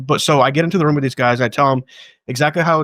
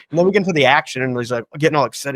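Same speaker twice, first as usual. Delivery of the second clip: normal talking voice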